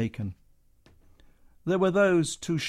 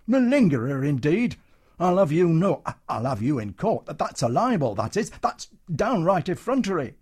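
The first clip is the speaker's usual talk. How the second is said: outraged bluster; 5 semitones higher, 6 dB louder than usual. Acted. Comedian outraged at Matron.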